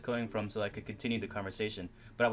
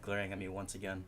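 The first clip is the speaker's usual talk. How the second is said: ordinary talk, nothing marked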